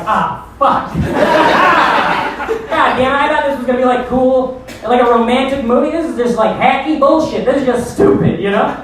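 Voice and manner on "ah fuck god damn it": high laughing groan of defeat; a crushed curse that breaks into laughter; dismayed laugh. Retold or imitated. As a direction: laugh at your own bad luck. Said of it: "And I was like".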